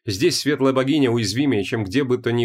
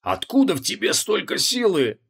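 wheezing; 8 semitones higher than usual. Acted. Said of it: "просипел Креол".

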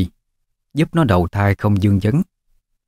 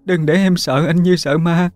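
high, desperate begging; wife begs her dying husband not to leave her, high pleading voice; 10 semitones higher than usual. Acted. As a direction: beg him not to die, voice high and strained. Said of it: "mà van xin".

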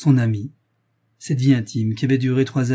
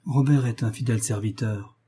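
ordinary delivery, nothing marked

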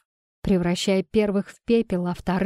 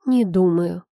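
hissed; 4 dB louder than usual. A narrator's reading. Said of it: "прошипела я".

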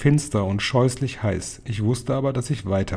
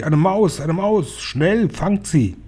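high, urgent mock shrieks; shouting crowd; 7 semitones higher, slower than usual. A narrator's reading. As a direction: raise the alarm in high-pitched cries. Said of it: "schrien sie".

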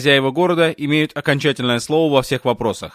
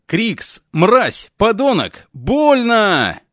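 screaming in pain; 7 semitones higher, much slower than usual. A narrator's reading. Wounded girl screams curses at the sheriff who shot her. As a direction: howl the insults through the pain.

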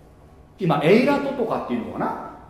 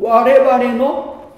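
slow, loud, stretched out; drawn-out emphasis; 7 semitones higher, 7 dB louder, much slower than usual. Real, not acted. Preacher building to God's name, slow and loud. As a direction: lean hard on it.

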